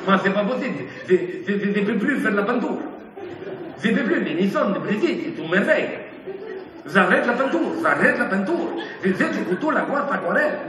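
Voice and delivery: lightly voiced Italian painter, low; hint of an Italian accent, dropping low. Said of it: "il est italien".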